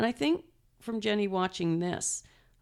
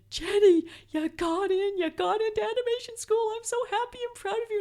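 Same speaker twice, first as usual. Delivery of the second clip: much higher, thrilled announcement; excited announcement. Retold or imitated. Mom bursting into class to tell her daughter she got in, much higher, thrilled. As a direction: burst out the good news, sky-high with joy. And I'm jumping up and down.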